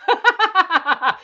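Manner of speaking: high, loud, rapid scoffing cackle; high staccato mock laughter. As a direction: burst into derisive laughter. Scoffs at the nobody claim.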